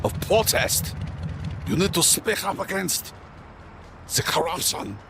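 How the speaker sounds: put-on high, slow French accent. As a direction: play Emile, the French guy. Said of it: "Is a French character gonna come out".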